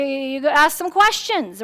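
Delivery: normal talking voice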